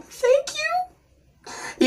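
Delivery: choked-up thanks that cracks into a high squeak; voice breaking. Retold or imitated. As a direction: let the voice break up high while trying not to cry. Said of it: "But it came out like this".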